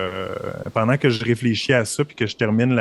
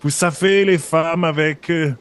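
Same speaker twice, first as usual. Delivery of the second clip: put-on higher, halting German-accented French; 4 semitones higher than usual. Impostor explaining himself to the guard, German accent. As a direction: improvise an awkward excuse in a German accent. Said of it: "il parle en français avec un accent allemand".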